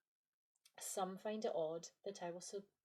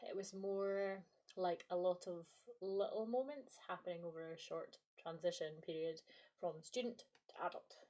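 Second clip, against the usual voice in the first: ordinary talk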